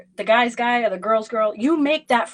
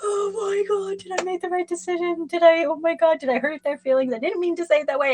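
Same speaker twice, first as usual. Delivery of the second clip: high, rushed, hammed-up panicking voice; mock inner panic; 9 semitones higher than usual. Tarot reader acting out the viewer's inner freakout, high mock panic. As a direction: squeal it out like a frantic overthinker.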